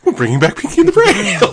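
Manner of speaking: high, loud trailer quote; mock excited announcement. Retelling the reboot trailer, excited announcement.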